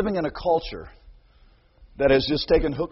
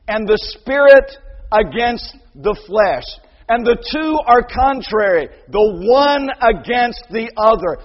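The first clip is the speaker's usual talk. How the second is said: slow, louder solemn reading; scripture quote; 4 semitones higher, 8 dB louder than usual. Real, not acted. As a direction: proclaim the verse. Quoting scripture.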